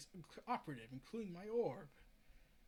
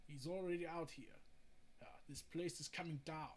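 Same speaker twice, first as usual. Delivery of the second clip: ordinary delivery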